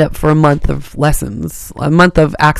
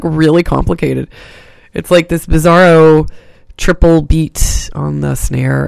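normal talking voice

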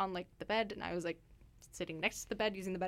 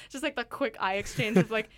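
usual speaking voice

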